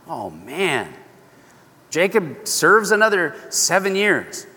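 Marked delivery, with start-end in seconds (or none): none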